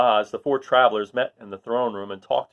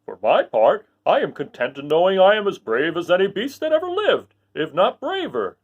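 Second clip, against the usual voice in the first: proud, louder Lion voice, slowing at the end; a big cat boasting then easing off; 7 semitones higher, 5 dB louder than usual. Acted. Lion telling friends he is brave. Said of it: "said the Lion modestly".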